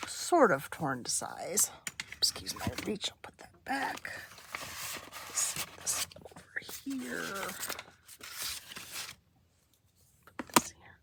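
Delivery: breathy whisper while crafting. Real, not acted.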